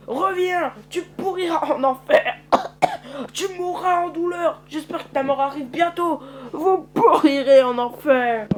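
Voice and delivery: high, loud furious curses; damn him as he walks away; cursing yell. Acted. Dying prisoner cursing his jailer, high and furious.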